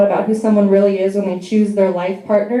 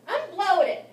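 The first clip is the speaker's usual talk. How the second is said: higher, softer self-pitying whine; mock girly sigh; 9 semitones higher, 8 dB quieter than usual. Girl at the restroom mirror to other women, soft mock whine. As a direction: play a woman fishing for comfort.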